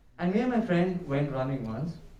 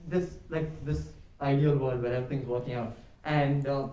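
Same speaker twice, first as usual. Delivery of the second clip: ordinary talk, nothing marked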